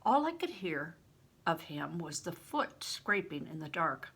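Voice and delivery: plain reading voice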